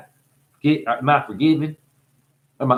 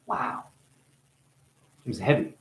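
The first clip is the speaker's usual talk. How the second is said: ordinary delivery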